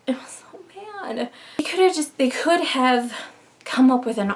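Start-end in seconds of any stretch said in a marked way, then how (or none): 0.0-1.3 hushed, voiceless whisper of disgust. Real, not acted. Vlogger whispers her verdict on the villain to viewers, disgusted whisper. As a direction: breathe it out as if it pains you.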